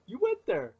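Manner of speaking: high-pitched mock-scandalized callout; retold delighted disbelief, pitched right up; gleeful accusation. Calling out a buddy's dark joke, gleefully scandalized. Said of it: "started smiling".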